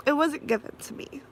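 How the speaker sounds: breathy whisper, barely voiced. Real, not acted. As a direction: whisper it through tears. Whispered emotional aside.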